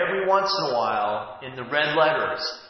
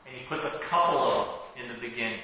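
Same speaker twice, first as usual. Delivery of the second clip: ordinary delivery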